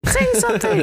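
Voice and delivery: very high-pitched imitated demand; mock outburst. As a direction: mimic someone losing it, pitch shooting way up. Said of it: "Luke just fucking goes off".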